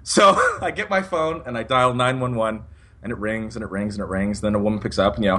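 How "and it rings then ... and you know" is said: ordinary talk, nothing marked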